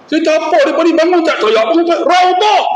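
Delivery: high-pitched retold exclamation; mock astonished outburst. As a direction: act out a bewildered man's protest. Acting out the man's astonished confession.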